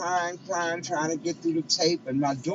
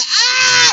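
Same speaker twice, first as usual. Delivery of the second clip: loud, high wailing scream; re-enacted scream; 15 dB louder than usual. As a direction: shriek as if hearing terrible news, retold. Retells scream at bad news.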